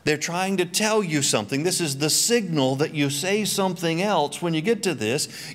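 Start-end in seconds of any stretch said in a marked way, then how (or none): none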